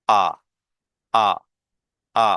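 drawn-out "আ" with the jaw dropped low; vowel demo. Shown as a demonstration. Teacher demonstrating the open vowel sound. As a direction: open wide and hold the ah. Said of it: "দেখেন আমার ... জটা down".

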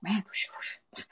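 slow, breathy whoosh of a listener left behind; soft whooshing sound of someone lost in fast talk; imitating a viewer blown away by fast talk; mock viewer whooshing. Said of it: "you're like".

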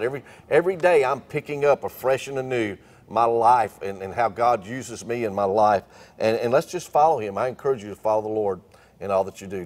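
usual speaking voice

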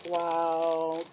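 normal talking voice

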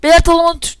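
ordinary talk

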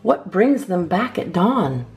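usual speaking voice

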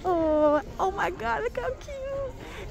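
excited squeal. Real, not acted. Shopper gushing to viewers over little Christmas trees, high excited squeal.

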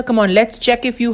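ordinary talk, nothing marked

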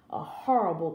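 ordinary delivery, nothing marked

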